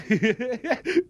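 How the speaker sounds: short rising chuckle; a quick amused laugh at his own joke. Acted. (kendi şakasına kısa kahkaha)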